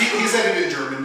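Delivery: ordinary talk, nothing marked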